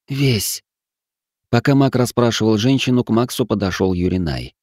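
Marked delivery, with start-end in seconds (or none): 0.1-0.6 pained. Acted. Sick woman answers the mage.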